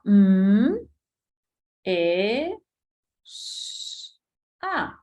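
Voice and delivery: M, E, S, A as separate sounds, the S whispered; sounding out letters. Shown as a demonstration. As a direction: spell out each sound of mesa with pauses between. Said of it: "produzco el sonido".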